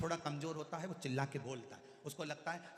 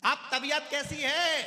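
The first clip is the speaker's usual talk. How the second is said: slow, much higher, much louder; shouted question; 11 semitones higher, 13 dB louder, slower than usual. Acted. Deaf visitor shouting a question to the bedridden patient. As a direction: yell it to someone you can't hear. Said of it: "अब इसने पूछ लिया".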